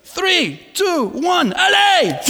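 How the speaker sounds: high, loud French countdown ending in allez; French countdown shout. Retold or imitated. French operator launching the rider with a loud high cry. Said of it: "he goes".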